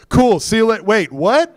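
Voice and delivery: high breezy goodbye turning to surprise; startled double take. Retold or imitated. Visitor leaving bedside hears phone saved a life, startled. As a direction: start leaving, then catch yourself startled.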